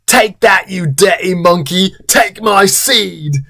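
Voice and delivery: high, hammed-up yell; Tom. A narrator's reading. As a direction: holler it in a put-on voice. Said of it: "Tom yells as he climaxes".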